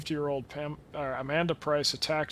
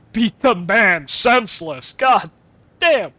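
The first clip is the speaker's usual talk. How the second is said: loud, slow, higher playful shout; mock yell; 5 semitones higher, 10 dB louder, slower than usual. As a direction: roar the order for fun. Orders beating in game, yelling.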